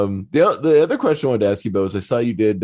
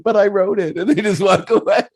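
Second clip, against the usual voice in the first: much higher and louder, laughing; mock indignant plea; 12 semitones higher, 5 dB louder than usual. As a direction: squeak it out, mock hurt. Retelling friend's ignored plea.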